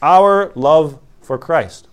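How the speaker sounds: slow, weighty "our"; drawn-out stress on "our love". Shown as a demonstration. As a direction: dwell on "our" to set it apart. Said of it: "the meaning is not".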